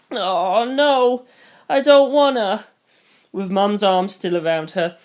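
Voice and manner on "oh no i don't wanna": put-on high, drawn-out childish whine; toddler tantrum. A narrator's reading. Girl refusing to leave mum's arms, childish tantrum. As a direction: refuse like an upset little kid. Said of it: "like a toddler throwing a tantrum".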